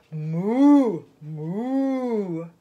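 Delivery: long, low moos; low-pitched cow call; cow mooing. Acted. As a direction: moo like a milk cow, drawn out. Cow character moos in story.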